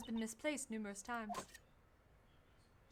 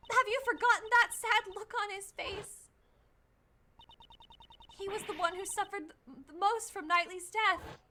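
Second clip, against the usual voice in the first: put-on higher, louder, pleading young girl voice; rally him not to give up, higher and louder; spirited young girl; 9 semitones higher, 3 dB louder than usual. Kay urging Edgeworth not to give up, higher pleading girl voice.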